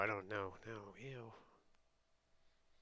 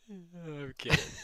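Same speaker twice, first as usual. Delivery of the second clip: laughing quote; 7 semitones higher, 8 dB louder, slower than usual. Retold or imitated. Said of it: "He's like".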